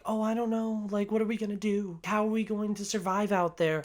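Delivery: acting out an anxious waverer; mock second thoughts. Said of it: "starts to get second thoughts".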